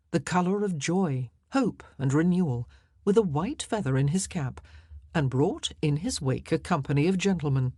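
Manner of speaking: ordinary delivery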